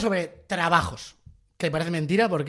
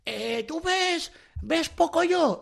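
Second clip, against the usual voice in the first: imitating a child; 10 semitones higher, much slower than usual. Child asking Olentzero.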